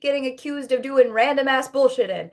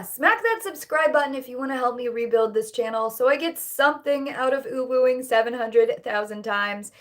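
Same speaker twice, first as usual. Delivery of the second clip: usual speaking voice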